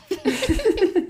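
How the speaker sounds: squealing laugh, high; a real laugh breaking out high; bright laugh at the memory; high burst of laughter. Real, not acted. Laughs at own story.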